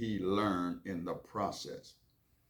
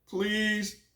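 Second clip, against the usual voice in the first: put-on higher, louder pleading child; whiny kid voice; 13 semitones higher, 5 dB louder than usual. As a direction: beg like a little boy wanting a cookie. Child Billy begs mom.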